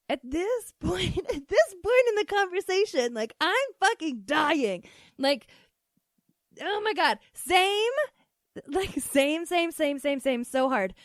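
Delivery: high, squealing amusement through real laughter; cracking up. Real, not acted. Host dying at mom's excuse in the conversation, cracking up. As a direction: lose it, laughing as you talk.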